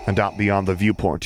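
usual speaking voice

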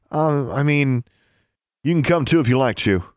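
contemptuous officer tone; condescending. A narrator's reading. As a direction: talk down to her. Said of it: "sounding more like the contemptuous, condescending".

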